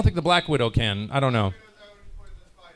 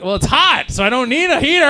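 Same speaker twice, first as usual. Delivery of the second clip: high, loud mock protest; comic exasperation; 11 semitones higher, 6 dB louder than usual. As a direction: protest the absurdity, pitch climbing high. Acted exasperation with hotel staff.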